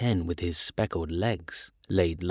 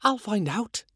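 tiny high ladybird voice; much higher storybook insect voice; 10 semitones higher than usual. A narrator's reading. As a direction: pipe up like a little ladybird. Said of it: "said the ladybird".

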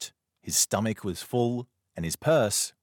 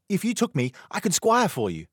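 put-on much higher hopeful boy; eager boy; 13 semitones higher, 5 dB louder than usual. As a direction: pitch yourself as his squire. Boy pleads to be squire.

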